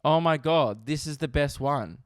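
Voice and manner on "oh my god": ordinary talk, nothing marked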